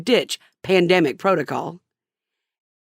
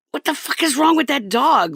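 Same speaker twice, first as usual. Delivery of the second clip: annoyed; 7 semitones higher than usual. Retold or imitated. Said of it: "extremely annoyed".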